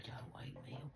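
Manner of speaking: soft unvoiced whisper. Real, not acted. Asking not to be woken, whispered. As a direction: hush it like a plea.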